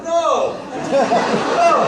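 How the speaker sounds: mock panicked no. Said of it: "I'm like".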